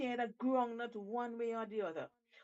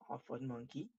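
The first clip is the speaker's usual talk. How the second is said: usual speaking voice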